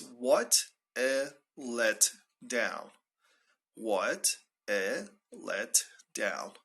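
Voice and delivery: very slow, word by word; slow model phrase. Shown as a demonstration. Teacher introduces an informal expression, very slowly. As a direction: spell out each word with pauses.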